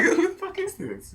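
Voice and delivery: high, laughing who-is-this; laughing disbelief. Retold or imitated. Reacting to the stranger demanding dap, laughing.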